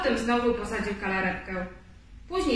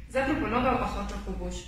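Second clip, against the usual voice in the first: usual speaking voice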